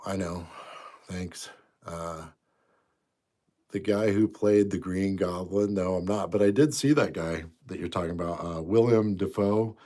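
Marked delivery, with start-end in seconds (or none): none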